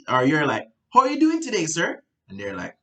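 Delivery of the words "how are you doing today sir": overexcited greeting. Shown as a demonstration. Salesperson greeting a flat client, overexcited. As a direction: gush at the client far too brightly. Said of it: "No, you don't want any of that".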